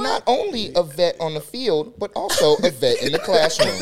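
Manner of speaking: imitating a friend's story narration, loud and slow; impression of a friend. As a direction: pitch it like a sports story voiceover. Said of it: "That's what you be on".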